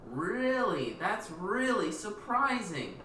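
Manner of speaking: slow, exaggerated mock amazement; Kevin's sarcasm. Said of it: "Exactly like that".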